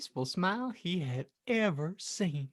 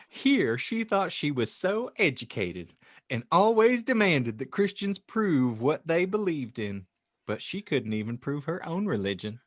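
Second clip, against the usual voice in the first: ordinary talk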